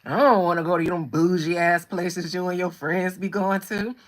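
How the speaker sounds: husband mocked, complaining about bougie places; mimicking husband. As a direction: grumble like a man who just wants ribs. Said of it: "He hates going out".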